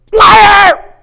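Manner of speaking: very high, very loud yell into a pillow; shouting liar. Shown as a demonstration. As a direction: scream it into the pillow. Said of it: "just go get a pillow".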